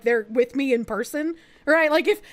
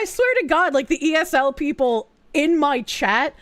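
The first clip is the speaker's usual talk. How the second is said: normal talking voice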